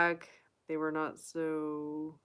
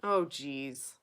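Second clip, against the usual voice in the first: ordinary talk, nothing marked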